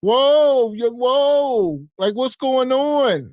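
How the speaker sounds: mock her surprise at no warning; mock whoa. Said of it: "Phone creature said".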